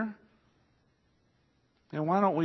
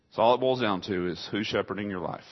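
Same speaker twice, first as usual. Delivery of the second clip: usual speaking voice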